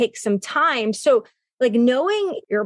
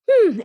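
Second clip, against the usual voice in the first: a loud, high thinking "hmm" before walking off; mock hum; 9 semitones higher, 4 dB louder than usual. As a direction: hum it out loud to step back from upset. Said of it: "I like to go".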